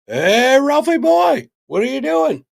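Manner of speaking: loud, high, swooping holler; hammed-up sing-out hello; mock cheery cop greeting. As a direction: call out like greeting an old buddy, over the top. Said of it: "and they didn't go".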